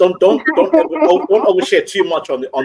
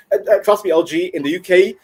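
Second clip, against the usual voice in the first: normal talking voice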